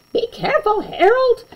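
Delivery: high anxious call to Harold; playful alarmed shout; mock scream. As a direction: cry out a warning up high. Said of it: "I heard my mom scream".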